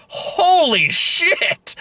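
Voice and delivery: high, drawn-out Texan exclamation; thick Texan accent. Retold or imitated. Movie character waking up mid-fall, thick Texan accent. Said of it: "in that thick Texan accent".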